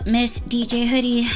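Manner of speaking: ordinary talk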